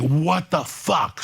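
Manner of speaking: high, slow, stretched-out mock disbelief; retold disbelief. Recalling his reaction to the setup, stretched high disbelief. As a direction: draw it out as if stunned.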